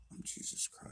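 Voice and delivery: whispered, very soft and airless; old man in the next kennel whispering his claim; eerie whisper. Retold or imitated. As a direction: breathe it like a secret revelation. Eerie old inmate claims divinity.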